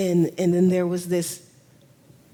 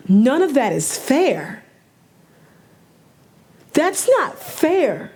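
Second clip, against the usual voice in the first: upset; 7 semitones higher, 4 dB louder than usual. Real, not acted. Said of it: "Clearly, I'm upset".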